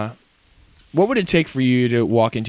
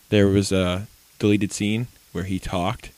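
ordinary delivery

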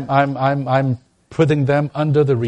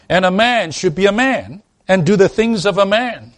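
high, loud preaching cry; 8 semitones higher, 4 dB louder than usual. Acted. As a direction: proclaim it from the pulpit. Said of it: "preaching at full force".

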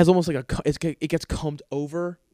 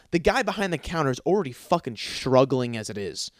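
normal talking voice

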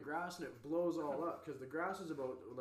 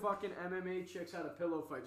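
ordinary talk

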